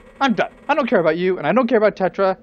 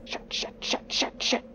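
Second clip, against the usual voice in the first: hushed, breathless whispered swearing; panicked whisper; 12 dB quieter than usual. Acted. Lineback panicking about becoming a whale, whispered curses. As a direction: swear under your breath in rising panic.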